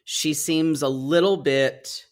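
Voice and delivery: usual speaking voice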